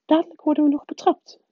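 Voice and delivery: high-pitched, hurried hushing; brisk, high professor trying to calm someone; whispering professor. A narrator's reading. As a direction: hurry the comfort along in a high voice. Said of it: "fluisterde professor Anderling".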